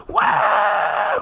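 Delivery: high, drawn-out mock whoop; big imitation crowd shout; crowd roar. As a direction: cheer like a whole crowd reacting. Imitating whole press crowd reacting.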